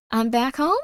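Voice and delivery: normal talking voice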